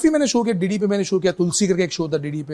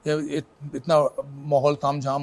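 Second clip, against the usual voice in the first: ordinary delivery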